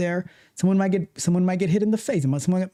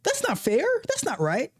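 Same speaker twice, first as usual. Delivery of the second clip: whiny protest; 8 semitones higher than usual. Retold or imitated. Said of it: "you get like".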